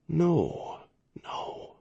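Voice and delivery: faint, fading into a whisper; dying man's breathless protest; weak voice. A narrator's reading. Dying admiral speaks weakly.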